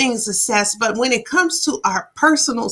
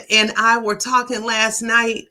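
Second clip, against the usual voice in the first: normal talking voice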